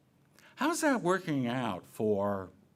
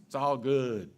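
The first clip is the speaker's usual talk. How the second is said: right inflection; 3 dB louder than usual. Shown as a demonstration. Preacher demoing the right breezy way to say it. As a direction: say it like you truly mean it's fine. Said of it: "You have to say it with the right inflection in the voice".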